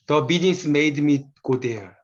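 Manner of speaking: very slow, each English word laid out clearly, stress on business; slow dictation-style reading of an English example sentence; slow careful English reading. Shown as a demonstration. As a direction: read The business made me go there word by word like an English teacher. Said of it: "이건 무슨 영어 수업 하는 것 같아요".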